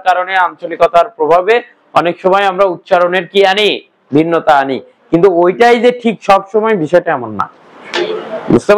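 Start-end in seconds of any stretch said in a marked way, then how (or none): none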